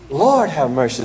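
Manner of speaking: ordinary talk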